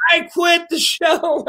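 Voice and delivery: put-on high, slow, whiny girl voice; mock a sulky teenager in a high voice; mimicking teen girl. Boss mimics the actress quitting in a high whiny voice. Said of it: "She goes".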